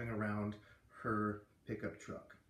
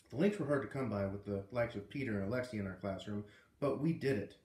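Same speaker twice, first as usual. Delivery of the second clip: ordinary delivery, nothing marked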